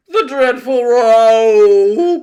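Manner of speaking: very loud, drawn-out wail; anguished cry. A narrator's reading. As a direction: wail it high and slow in anguish. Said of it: "cried the anguished din".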